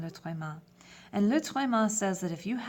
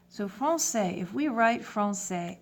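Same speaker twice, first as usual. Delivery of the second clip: normal talking voice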